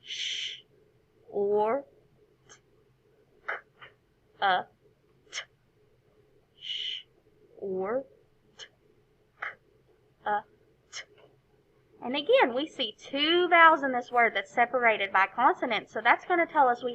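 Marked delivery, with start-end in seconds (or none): 0.0-11.0 "Sh, or, t, k, a, t" sound by sound; individual sounds. Shown as a demonstration. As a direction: isolate each of "Sh, or, t, k, a, t" with a pause. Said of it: "each individual sound that is shown".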